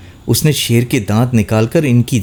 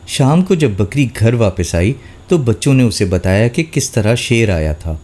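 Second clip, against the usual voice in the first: usual speaking voice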